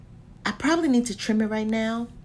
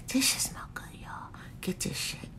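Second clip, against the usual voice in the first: hushed urging; conspiratorial whispered recommendation; 3 semitones higher, 12 dB quieter than usual. Real, not acted. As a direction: whisper it like a tip to a friend.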